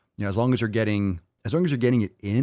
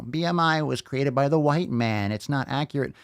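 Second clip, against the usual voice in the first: higher, mocking voice of a BMI denier; mocking whiny critic; 5 semitones higher than usual. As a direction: sneer it like a know-it-all critic. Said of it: "jabronis will always, like, you know".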